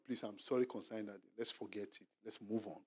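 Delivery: soft, hushed plea to move on; soft apology. Retold or imitated. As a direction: let the sorry fade quietly. Spouse apologizing softly.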